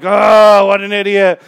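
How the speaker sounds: mock upset, loud groaned "Oh"; exasperated sighing. Impatient person upset with others, exasperated sighing. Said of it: "a patient person doesn't sigh all the time".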